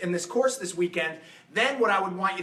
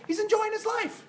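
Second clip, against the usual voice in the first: delighted. Real, not acted. High sung-out delight.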